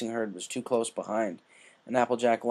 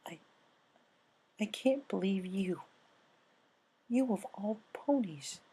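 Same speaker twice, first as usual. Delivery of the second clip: softer, slower, stunned disbelief; a wounded hush; hurt; 9 semitones higher, 6 dB quieter than usual. Acted. Rainbow hurt by Applejack dropping out, quietly stunned.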